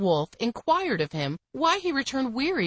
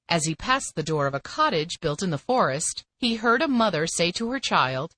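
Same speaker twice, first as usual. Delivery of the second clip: plain reading voice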